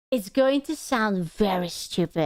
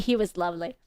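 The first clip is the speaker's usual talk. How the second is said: normal talking voice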